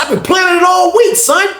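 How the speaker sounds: hammed-up movie-villain boast, pitched very high; theatrical high shouted brag; Denzel Washington-style outburst. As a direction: shout it like a big dramatic screen confession. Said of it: "I went goddamn Denzel on his ass".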